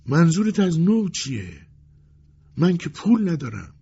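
high-pitched bewildered protest; stunned, helpless customer; dazed. A narrator's reading. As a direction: reel at the news, voice high.

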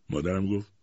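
plain reading voice

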